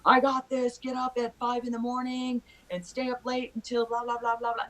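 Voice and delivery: high, hammed-up pep-talk voice; mock motivational voice. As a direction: parody a hyped-up motivational guru. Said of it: "these things, Sherry, that say".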